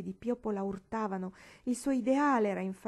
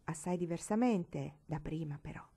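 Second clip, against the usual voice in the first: ordinary delivery, nothing marked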